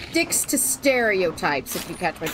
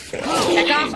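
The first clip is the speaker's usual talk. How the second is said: louder, higher warning; 7 semitones higher, 4 dB louder than usual. Real, not acted. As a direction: raise the voice to shoo it off. Shooing enemy again, more forcefully.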